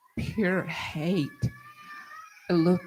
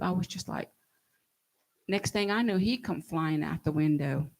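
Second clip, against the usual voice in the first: ordinary talk, nothing marked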